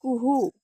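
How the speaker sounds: imitating a cuckoo's kuhu call. Shown as a demonstration. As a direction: sing out kuhu like the bird. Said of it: "कशी ओरडते?".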